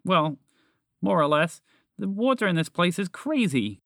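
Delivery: high, eager character voice; excited. Acted. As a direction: gush about the discovery. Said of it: "Roger said excitedly".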